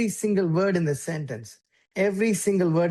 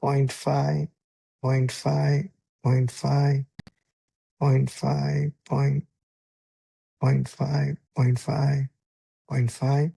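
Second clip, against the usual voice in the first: slow, even pauses between point and five; evenly spaced words; 4 semitones lower, much slower than usual. Shown as a demonstration. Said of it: "we said you can have".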